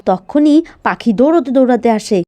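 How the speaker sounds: plain reading voice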